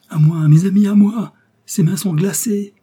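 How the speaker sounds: loud, high shout for help; rally friends in sheer alarm; panicked cry. A narrator's reading. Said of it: "s'écria D'Artagnan". (cri d'alarme, fort et aigu)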